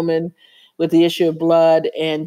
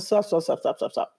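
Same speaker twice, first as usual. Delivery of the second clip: softer, fading to a whispered stop; hushed stop; 4 semitones higher, 6 dB quieter than usual. Retold or imitated. Cutting off the friend before the bad news. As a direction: cut her off, breathless.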